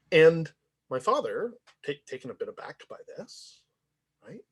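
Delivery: ordinary delivery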